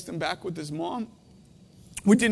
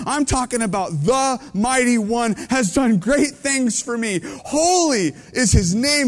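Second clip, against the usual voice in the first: louder, rising preacher's proclamation; full-force preaching; 4 semitones higher, 5 dB louder than usual. Acted. Preacher proclaiming Mary's song to the congregation, louder. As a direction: proclaim it to the whole room.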